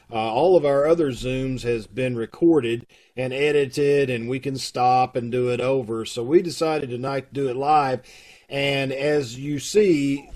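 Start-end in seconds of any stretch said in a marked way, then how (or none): none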